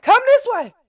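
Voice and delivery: high, loud urging to come; urgent calling. Retold or imitated. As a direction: shout her over, fast and worried. Mother urging daughter toward her.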